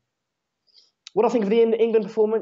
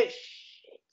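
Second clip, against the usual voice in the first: whispered curse; 16 dB quieter than usual. Real, not acted. England attack, muttered curse.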